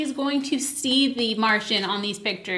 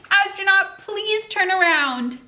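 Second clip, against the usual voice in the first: calling out; 7 semitones higher, 3 dB louder than usual. Shown as a demonstration. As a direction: urge the astronaut to turn around.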